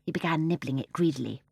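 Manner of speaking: ordinary delivery, nothing marked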